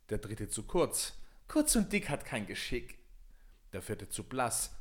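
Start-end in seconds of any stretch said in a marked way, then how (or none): 1.5-3.0 mocking princess. Spottende Prinzessin.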